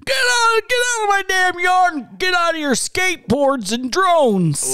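put-on high, loud, strained old codger; stressed-out old man. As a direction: chase kids off like a cranky old man. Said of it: "prank call as an old man".

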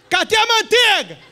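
loud, high comic back-and-forth at the fridge; husband and wife shouting about the butter, laughing; comic bickering. Acted. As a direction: play husband and wife yelling over the butter.

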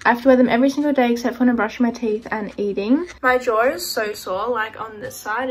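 ordinary delivery

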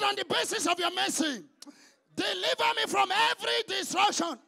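fervent shouted prayer with long held Oh Lords; a pastor leading the congregation in loud prayer; preaching prayer. Acted. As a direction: cry out each plea to God.

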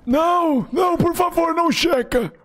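high, panicked shouting; begging in sheer panic; panicked pleading. Real, not acted. Panicking as the guard checks.

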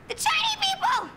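sudden high-pitched shout; paranoid outburst. Acted. Street dweller warning of who doctors work for, paranoid high shout. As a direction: shriek it like a raving conspiracy theorist.

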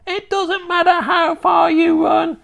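high, loud witch shout. A narrator's reading. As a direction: shout after him like a hag. Witch shouts after fleeing boy.

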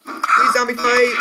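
normal talking voice

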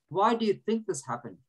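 ordinary talk

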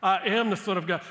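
bold, forceful retort, punching AM; imagined Jesus firmly asserting who he is; emphatic declaration. Acted. Jesus answering the devil's doubt, emphatic and defiant. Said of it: "You almost just wish he would go".

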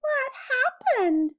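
high, startled mouse cry; alarmed at finding his friend in the box; surprised. A narrator's reading. Mouse finding Willie among the old toys, surprised.

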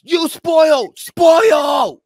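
high, loud mock complaint, drawn out; mocking outraged yell. Host mimicking someone complaining online about spoilers, high mock yell. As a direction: imitate an outraged viewer shrieking in protest. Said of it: "when someone's like".

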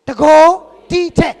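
loud proclamation of တကိုယ်; loud emphatic answer. Real, not acted. Preacher proclaiming the answer to the church. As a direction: call out the answer with force.

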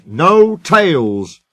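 stern storybook reprimand; firm. Acted. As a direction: cut them off with drawn-out authority. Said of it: "said Skarloey firmly".

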